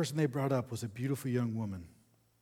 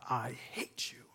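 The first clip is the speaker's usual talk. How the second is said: hushed, seething hatred; intense rage; 5 dB quieter, slower than usual. A narrator's reading. Woman to her new owner, raging under her breath. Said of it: "with intense rage and anger".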